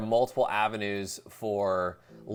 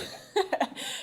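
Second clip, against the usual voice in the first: high breathy giggle. Real, not acted. Laughs at weird question.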